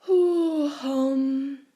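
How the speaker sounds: loud drawn-out yawning ho hum; big sleepy yawn. A narrator's reading. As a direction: yawn it out like a drowsy old tortoise. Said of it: "yawned Mister Tortoise".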